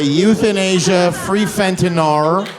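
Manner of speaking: slow, loud billboard read; mock sign announcement. Comic reading out big signs, slow mock announcer. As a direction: proclaim it slowly like a big advertisement.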